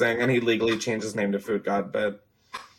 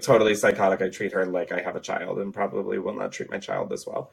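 ordinary delivery

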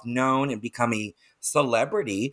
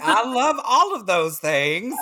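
gushing, high and drawn out; delighted enthusiasm; 10 semitones higher, 4 dB louder than usual. Real, not acted. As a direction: relish it loudly, pitched way up, on the edge of laughter. Delighted reaction to guest's self-description.

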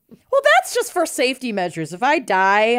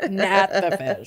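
slow drawn-out; 4 semitones lower, 3 dB quieter, slower than usual. Retold or imitated. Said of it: "she anticipated your question".

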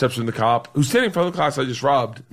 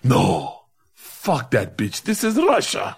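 put-on Russian accent; slower than usual. Cop dismissing the teacher, slow mock Russian accent. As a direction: lean in close and drawl it Russian. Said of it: "he goes".

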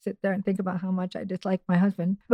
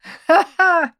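big real laugh; loud laugh; 9 semitones higher, 8 dB louder than usual. Real, not acted. Husband's joke makes her laugh.

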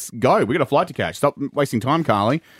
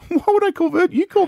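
laughing disbelief; 4 dB louder than usual. Real, not acted. Incredulous at being asked to call.

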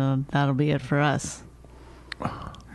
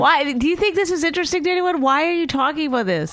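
much higher, quick squeaky voice; high-pitched bickering; 6 semitones higher than usual. Pretend. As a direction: squeak it like a nagging spouse.

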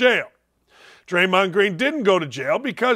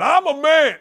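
high, punched-up mock protest; hammed-up athlete insisting on it; mock macho boast; 8 semitones higher, 4 dB louder than usual. Host mimics every athlete he hears, mock macho boast. Mocks athletes claiming manhood.

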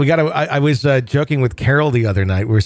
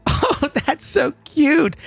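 much higher, slow gushing squeal; dad mimicking the girls; imitating cooing girls; 13 semitones higher, much slower than usual. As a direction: gush like a teenage hostess. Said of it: "the girls go".

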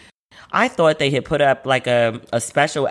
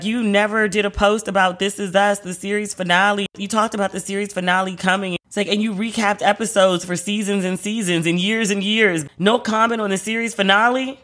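higher-pitched, mock-accusing nagging; imitated complaint; 6 semitones higher than usual. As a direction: imitate someone calling you out, pitched up. Retelling someone's complaint about missing post.

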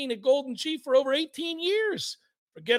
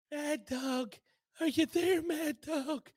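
put-on soft, higher, bewildered old man; confused old coach; 5 semitones higher, 7 dB quieter, slower than usual. Old coach searching for the host on air, softly bewildered. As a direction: call out faintly, unsure anyone is listening.

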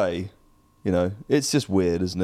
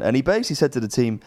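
ordinary talk

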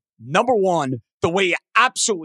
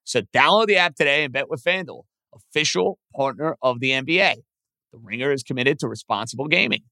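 ad read; 5 semitones lower than usual. Acted. Host reads sponsor ad.

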